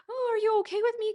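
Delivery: high, loud, fast anxious plea; needy clingy check-in, rushed and high. Pretend. Wife voicing an anxious partner, high fast worried plea.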